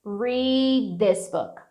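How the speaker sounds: very slow "read" gliding into "this"; slow-motion linking of "read this book"; held-back D. Shown as a demonstration. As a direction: draw out "read" and link its unreleased d to "this". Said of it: "eu dou uma segurada nesse D".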